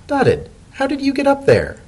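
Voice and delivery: a high surprised question; Spinning Top surprised. Acted. Secretary finding her boss in the chimney, high and startled.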